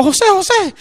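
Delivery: high-pitched urgent call of a name. Retold or imitated. New convert running to José's door for help, calling urgently. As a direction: reenact calling out as you run up.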